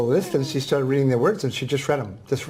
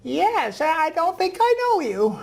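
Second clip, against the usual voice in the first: put-on high, slow frail old lady; old woman voice. Guest imitates the elderly reading student, high and slow. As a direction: voice the ninety-four-year-old gently. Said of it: "I'm gonna use a voice that s-, sounds like her".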